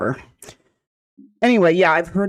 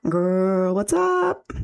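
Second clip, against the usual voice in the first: put-on high girly greeting, rising steeply; 4 semitones higher, much slower than usual. Streamer clowning for her viewers, put-on girly voice. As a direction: swoop way up into a mock girl voice.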